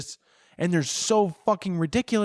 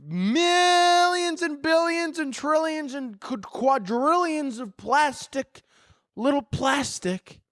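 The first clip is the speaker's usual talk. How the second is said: 1 rising rant; 10 semitones higher, much slower than usual. Real, not acted. Ranting, pitch climbs on plastic.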